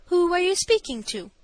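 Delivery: high, loud and stately challenge; stately indignation. A narrator's reading. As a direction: demand it in your stateliest manner. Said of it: "I inquired in my stateliest manner".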